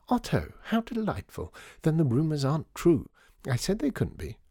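high-pitched, gushing greeting; delighted society lady. A narrator's reading. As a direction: play a society lady thrilled to see an old friend.

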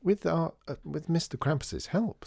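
put-on high little-girl voice, catching a slip; high, hesitant would-be innocent girl; feigned innocence. A narrator's reading. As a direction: answer innocently as a child, correcting yourself. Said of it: "trying to look innocent".

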